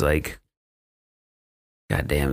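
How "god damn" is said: low, understated disappointment; retold disappointment. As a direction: act out letdown in a lowered voice. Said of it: "he's fucking disappointed".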